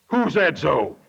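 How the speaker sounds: low, unruffled owl voice; old Mister Owl. Retold or imitated. Old Mister Owl looks down on the panicked bunch, low owl voice. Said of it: "he looked down and said".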